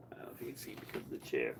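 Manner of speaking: fast, soft whispered aside; hushed aside to viewers. Real, not acted. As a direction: whisper so the cat stays. Aside while holding cat.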